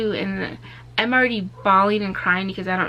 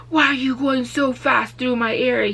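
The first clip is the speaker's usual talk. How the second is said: aggressive officer; 5 semitones higher, 3 dB louder than usual. Retold or imitated. Officer voiced in retold stop.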